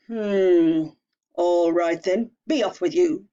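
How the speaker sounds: loud, gruff troll giving in; grudging troll. Acted. Troll letting the little goat pass, grudging and loud. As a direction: let the goat pass, grumbling.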